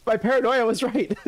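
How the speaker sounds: high, exclaiming in comic dismay; worked-up, high-pitched protest; vindicated outcry. Real, not acted. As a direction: crow that your suspicion came true. Suspicion confirmed, friend is gone.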